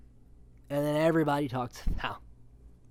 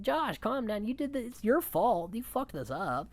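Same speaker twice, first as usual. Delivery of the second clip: higher, half-joking telling-off; mock scolding; 8 semitones higher than usual. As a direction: scold him like a friend, pitched up. Scolding Josh in retelling.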